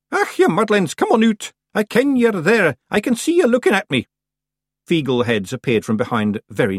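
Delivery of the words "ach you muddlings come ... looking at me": high, loud call to hidden little men; raised voice. A narrator's reading. Old witch calling the hidden Feegles out, loudly. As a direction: rouse them out of hiding, loud and high. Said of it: "and raised her voice".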